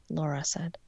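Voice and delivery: plain reading voice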